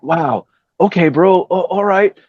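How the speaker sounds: slow, stunned wow; awed. Retold or imitated. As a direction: let it sink in slowly, dumbfounded. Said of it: "So I'm like".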